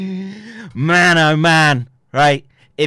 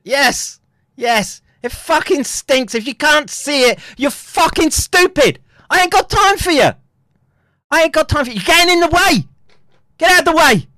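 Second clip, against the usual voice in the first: high-pitched shouting, building louder; angry rant; 11 semitones higher, 4 dB louder than usual. Real, not acted. Streamer berating people who can't see it, angry shouting. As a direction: berate them, climbing to a full yell. Ranting at doubters in his way.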